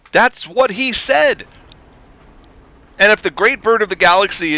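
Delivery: high-pitched, indignant fan rant; exasperated rant. Acted. Fan telling overanalyzers the creator said so, exasperated.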